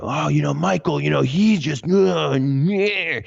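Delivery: high, hammed-up mimicry of a gossiping relative; mocking sibling voice. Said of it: "They would go".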